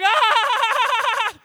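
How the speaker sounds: long, high, loud held "aah"; acted scream. Storyteller acting out the prank gone wrong, long high scream. Acts out the pained scream after the scare.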